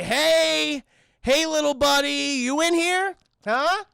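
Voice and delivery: high, drawn-out coaxing call; acted singsong coaxing, slow and loud. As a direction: call gently upward to a hidden little creature. Acted man coaxing hidden creature.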